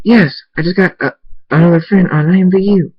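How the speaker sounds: high, loud happy yelling from upstairs; excited. Acted. Abel calling down to Thundy, excited and loud. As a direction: call it out, thrilled. Abel thrilled about new friend.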